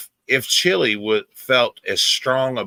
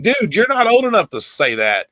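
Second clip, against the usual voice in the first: higher mock-scolding of a kid; higher retold telling-off of an eleven-year-old; 11 semitones higher than usual. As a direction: tell the kid off, pitched up. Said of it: "I'm like".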